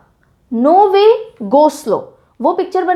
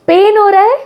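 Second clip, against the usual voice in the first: pained, raised mock complaint; acted pain; 7 semitones higher, 6 dB louder than usual. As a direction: cry out in pretend pain. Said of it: "I'm a good actor by the way".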